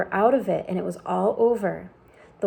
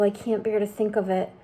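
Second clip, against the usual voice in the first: plain reading voice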